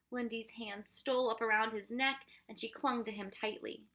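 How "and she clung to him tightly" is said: ordinary delivery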